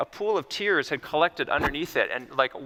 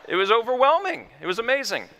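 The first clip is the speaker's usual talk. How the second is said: high, loud burst of awe; excited; 8 semitones higher, 4 dB louder than usual. Real, not acted. Doctor telling the audience about the patient's breakthrough, excited. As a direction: let the amazement spill out.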